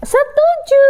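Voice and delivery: high, cheery chorus of little animals; friends agreeing. Acted. Animal friends accept the idea, high eager cheer. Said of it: "kata teman-temannya gitu".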